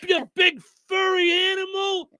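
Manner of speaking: high mock cry at the furry animal; hammed-up panicked officer; frantic cop. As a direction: wail it, then correct yourself. Mocking the cop yelling.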